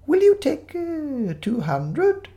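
very high, hesitant offer; quavery elderly vicar. Acted. Fake vicar makes Desmond an offer, high and quavery. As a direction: waver over the bid like an old vicar.

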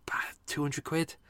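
hushed, rising disbelief; whispered outrage. Real, not acted. Complaining about Evans prices, hushed disbelief.

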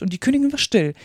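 ordinary delivery

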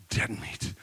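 hushed, doomed aside; dreading son. Acted. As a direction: repeat it under your breath in dread. Son repeats his doom.

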